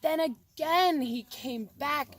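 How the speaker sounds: usual speaking voice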